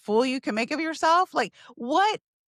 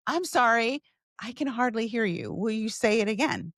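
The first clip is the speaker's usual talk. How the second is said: normal talking voice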